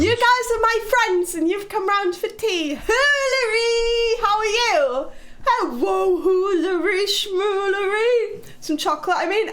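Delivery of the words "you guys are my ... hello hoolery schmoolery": high, sing-song welcome with stretched-out words; daft, drawn-out high-pitched hello; silly excited greeting. Pretend. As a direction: play the over-eager host greeting friends. Playfully greeting viewers as visiting friends.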